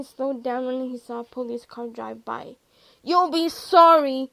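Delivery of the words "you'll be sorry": loud, slow, higher threatening shout; drawn-out shouted threat. A narrator's reading. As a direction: yell the warning after them. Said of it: "he shouted".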